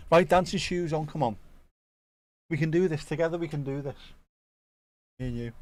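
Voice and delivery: rally yourself with cheery energy; pep talk. Real, not acted. Hyping himself up.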